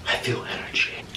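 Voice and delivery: whispered, mock-spooky ghost hunter; crazy ghost hunter. Mimicking the ghost hunter sensing energy, eerie whisper. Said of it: "She would just go crazy".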